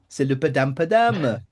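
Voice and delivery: mock French.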